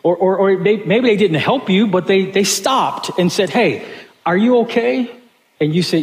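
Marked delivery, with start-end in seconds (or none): 3.5-5.1 higher, calling out hey, are you okay; a passerby calling to a stranded driver; concerned stranger. Acted. Stranger calling from the road to a stuck driver, concerned. Stranger stopping at roadside breakdown.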